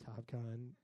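low soft sleepy murmur; drowsy mumble. Retold or imitated. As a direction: drift it out half asleep. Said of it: "I wake up and I go".

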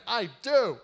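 loud, high-pitched mock outburst; hammed-up tantrum, pitch climbing; angry. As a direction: throw an exaggerated angry fit. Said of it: "he's sitting there angry and upset".

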